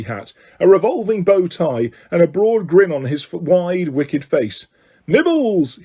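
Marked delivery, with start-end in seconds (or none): 5.1-5.8 high, drawn-out sugary offer; sweet. A narrator's reading. Peeves offers snacks to the guests, sweetly. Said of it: "he said sweetly".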